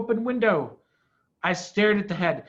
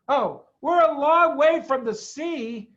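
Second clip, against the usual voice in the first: calling back; 8 semitones higher, 7 dB louder than usual. A narrator's reading. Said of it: "I called back to him".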